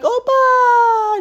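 high, loud cry of a name; excited mock cry. Kids calling their grandpa Opa, high and loud mock cheer. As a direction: call out in an exaggerated high voice.

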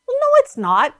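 high-pitched mimicked contradiction; mock naysayer. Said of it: "And people would say".

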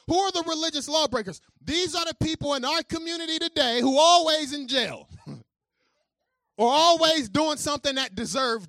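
high, loud preaching. Acted. Pastor driving home the irony to the congregation, full-force preaching.

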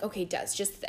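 soft, low, measured reassurance; hushed effort at keeping calm; retold calming voice; trying to be calm. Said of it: "trying to be calm".

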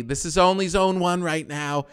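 high, pointed passenger remark; retold loud complaint. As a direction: say it so the whole line hears. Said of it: "People were saying loudly".

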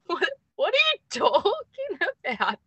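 high, slow, giggly disbelief; laughing bafflement. Retold or imitated. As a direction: retell your confusion through a laugh. Acting out her own baffled reply.

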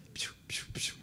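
short hushed hisses to summon someone; imitated hissing call. Fussy diner summoning a waiter, imitated hissing call. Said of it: "ele chamou o garçom assim".

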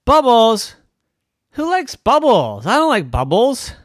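put-on high, loud scoffing little girl; Chloe, scoffing child. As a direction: sneer at the bubbles like a stubborn kid. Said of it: "Chloe said".

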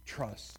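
"Trust" said alone with a falling tune; single word "Trust" as a point; stress on trust. Shown as a demonstration. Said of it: "emphasis on every single word".

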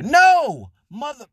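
sudden high, loud shout of no. Acted. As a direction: yell the refusal. Shouted refusal to vegan.

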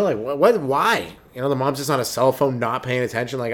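ordinary talk

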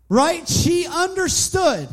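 loud, high preacher's climax; fervent loud sermon peak; preaching at full force. Real, not acted. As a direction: drive the point home to the congregation.

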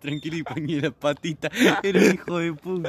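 talking through laughter; laughing. Real, not acted. Friend telling the bike story, laughing through the words. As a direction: crack up while telling it.

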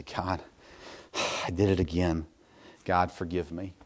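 sigh, then a low, soft plea; subdued, repentant prayer; weary confession. Retold or imitated. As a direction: confess with a heavy sigh, voice dropped. Weary repeated confession to God.

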